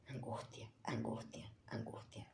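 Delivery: breathy whisper, almost soundless; whispered dread. Acted. Host whispers the victim's dread to viewers, hushed. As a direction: let the word die in a whisper.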